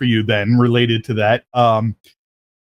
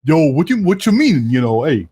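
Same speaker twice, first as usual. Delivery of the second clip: light Brooklyn tough-guy "Yo, what you mean"; a hint of Brooklyn street talk; playful Brooklyn accent impression; mock Brooklyn accent; 4 semitones higher than usual. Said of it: "take on, you know, that Brooklyn accent".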